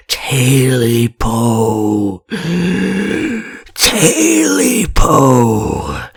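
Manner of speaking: slow, drawn-out ghostly wail; eerie creature wailing beside the bed. Acted. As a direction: wail it out close by in the dark. Creature in his room.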